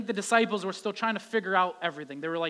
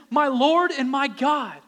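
awed confession; 7 semitones higher, 10 dB louder, slower than usual. Acted. Thomas's confession.

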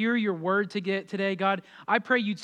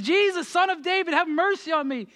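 imitated desperate shout, pitched very high; shouting louder; 9 semitones higher, 4 dB louder than usual. Blind beggar told to shut up calls Jesus, shouting higher. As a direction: push the plea up high over the crowd. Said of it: "shouts louder and says".

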